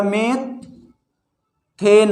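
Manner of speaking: "Methane" stretched out with a long gap after मे/मेथ; syllable split. Shown as a demonstration. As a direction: build "methane" slowly in two separate pieces, मेथ... एन. Teacher joins meth and ane. ("Methane" को दो हिस्सों में, लंबा रुककर)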